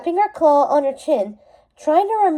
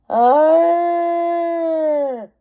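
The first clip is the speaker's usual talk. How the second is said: long, higher beast roar; monster roar; 4 semitones higher than usual. Acted. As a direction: howl like a mythical beast. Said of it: "It sounded like an angry ghost".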